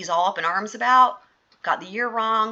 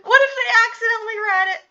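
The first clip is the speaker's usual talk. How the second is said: high, fast, crying mock alarm; mock panic; 5 dB louder, faster than usual. Joking to viewers about callers reading "Do not read", mock panicked cry. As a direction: squeal it like a comic little panic.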